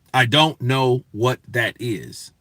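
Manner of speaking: slow, word by word; deliberate emphasis. Retold or imitated. Answering people who say it wrong, slow and deliberate. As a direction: spell out the confusion one word at a time.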